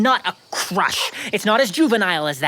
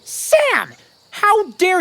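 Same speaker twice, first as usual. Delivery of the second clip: sharp indignant cry, much higher; indignant outburst; 11 semitones higher, 4 dB louder, much slower than usual. Acted. Detective rebuking his assistant over Dolores, indignant and high. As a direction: scold him for the insult.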